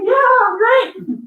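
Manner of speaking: put-on high, drawn-out chirp of a kid; imitating an overjoyed daughter; excited little kid. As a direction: squeal it slowly like a thrilled child. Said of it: "she was so excited".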